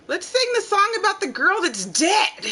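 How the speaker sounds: put-on a kid pleading to sing her favorite; lightly voiced cheerful child; a hint of a little girl. Child requests a song.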